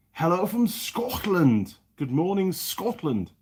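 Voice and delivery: high, drawn-out mock Scottish greeting; attempted Scottish accent. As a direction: call it out in an improper Scottish accent. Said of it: "can't do a proper Scottish accent".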